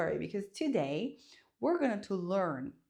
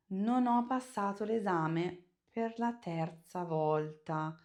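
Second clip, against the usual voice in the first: ordinary talk, nothing marked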